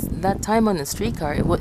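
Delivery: ordinary talk, nothing marked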